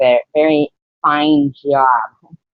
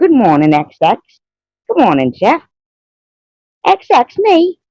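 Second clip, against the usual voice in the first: squeaky high playful chat voice; high silly voice for the chat; high put-on voice; 10 semitones higher than usual. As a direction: greet them in a high cutesy voice.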